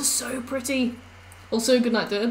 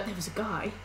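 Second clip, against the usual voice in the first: usual speaking voice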